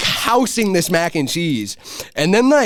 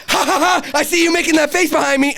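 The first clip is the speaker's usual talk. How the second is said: high-pitched imitation of a gotcha; mock taunting friend; 13 semitones higher, faster than usual. Rapper turns on his tripping friend, mock taunting. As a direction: tease in a much higher voice.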